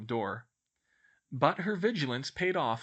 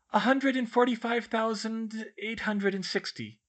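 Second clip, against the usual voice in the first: put-on high, casual know-it-all girl; 11 semitones higher, 4 dB louder than usual. A narrator's reading. Hermione answers a friend's sum, casual. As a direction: answer offhand in a higher voice. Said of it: "she replied casually".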